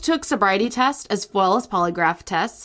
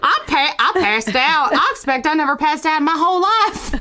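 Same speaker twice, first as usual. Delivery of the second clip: put-on Southern accent; 5 semitones higher than usual. Improvising an alien abduction witness, Southern accent. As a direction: play a rattled Southern witness. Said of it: "If you like our Southern accent".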